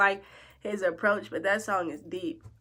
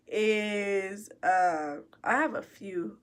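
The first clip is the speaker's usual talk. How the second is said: ordinary talk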